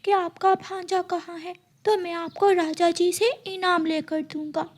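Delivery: put-on high, soft child bird; a small son warning his mother gently; little chick voice. Chick warns mother not to trust crow, high and soft.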